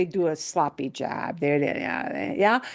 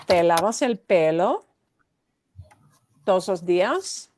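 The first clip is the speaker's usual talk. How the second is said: Te lavas el pelo todos los días with a rising question tune; rising intonation across ¿Te lavas el pelo todos los días?; 2 semitones lower than usual. Shown as a demonstration. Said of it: "it's gotta sound like this".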